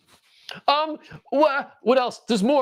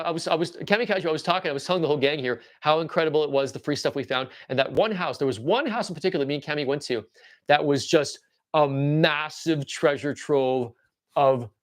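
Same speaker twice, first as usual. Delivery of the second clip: ordinary talk, nothing marked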